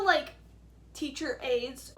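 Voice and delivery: normal talking voice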